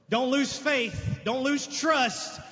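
slow, deliberate, stressing lose; slow earnest appeal; slow pleading. Real, not acted. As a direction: lean on each word to encourage. Pleading repetition.

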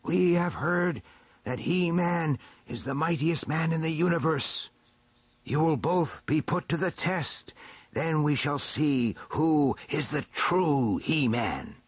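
high, measured proclamation; stately chief elder. A narrator's reading. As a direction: pronounce judgment on both rivals. Elder pronounces the test.

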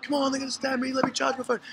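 hammed-up tearful begging; mock sob to get the phone charged; fake crying plea. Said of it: "started working up, like, started crying".

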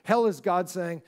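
ordinary delivery

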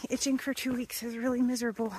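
ordinary delivery

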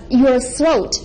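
usual speaking voice

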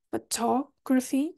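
photography in English, slow, stress on tog; English pronunciation. Shown as a demonstration. Teacher showing the English photography, slowly. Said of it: "em inglês o foco é".

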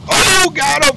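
high, loud burst of triumph; excited shout. Real, not acted. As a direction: yell it out as the kill lands. Kill shot in game.

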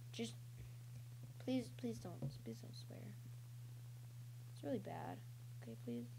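very quiet, low plea; soft pleading. Real, not acted. Player asking viewers not to swear, soft pleading. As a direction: beg gently, almost under your breath.

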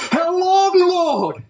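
high, drawn-out cry to God; lamenting prayer. Acted. Preacher asks God how long, lamenting. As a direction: wail it upward as a plea.

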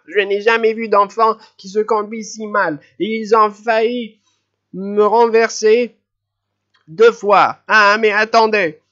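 high, loud furious scolding; furious old lady. A narrator's reading. Old lady furious at the running children, high and loud. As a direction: let the fury boil over. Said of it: "rouge de colère".

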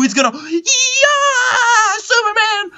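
high, stretched hype cry; triumphant scream. Real, not acted. Gamer hyping a Lee Sin play, high triumphant scream. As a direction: scream it like a hero taking flight.

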